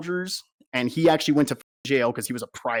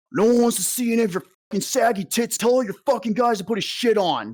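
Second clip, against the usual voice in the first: higher, mock barking sergeant; imitating the platoon sergeant; 8 semitones higher than usual. Platoon sergeant telling the soldiers to cover up, mock angry bark. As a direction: play the sergeant chewing out the class, pitched up.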